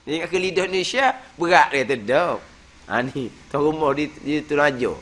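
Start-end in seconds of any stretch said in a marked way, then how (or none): none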